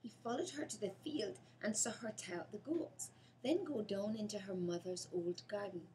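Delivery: ordinary delivery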